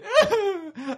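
high shrieking laugh-cry, very loud; hysterical laughing sob. Acted. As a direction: break into a wild laughing wail. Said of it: "You're going".

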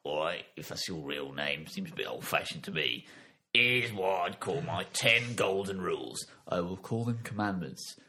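low, offhand Cockney Almighty; put-on low Cockney boss voice; Cockney God. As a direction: hand down the law like an East End geezer. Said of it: "Cockney voice, it's God".

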